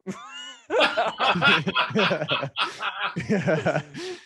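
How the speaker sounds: loud, high rolling laugh; real uncontrollable laughter; burst of laughter. Real, not acted. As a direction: crack up loudly at the absurd question. Big laugh at troll's question.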